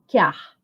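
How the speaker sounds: "que ar" said as a single syllable, "quiar"; elisão. Shown as a demonstration. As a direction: demonstrate "que ar" with the vowels glided together. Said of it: "a gente fala assim". ("que ar" juntado numa sílaba só)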